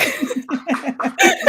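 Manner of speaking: bright burst of laughing; laughter. Real, not acted. Owning up to a stuffy, academic taste, laughing. Amused at her own description.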